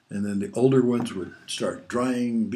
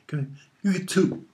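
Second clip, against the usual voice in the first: mom scolding; 8 semitones higher than usual. Retold or imitated. Mom catching kids at door.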